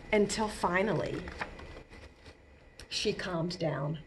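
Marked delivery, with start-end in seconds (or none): 2.9-4.0 softer, slow settling down; hushed calm. Acted. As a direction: let the storm die away. Tantrum ends, voice drops.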